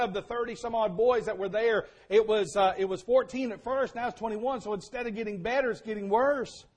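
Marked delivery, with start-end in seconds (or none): none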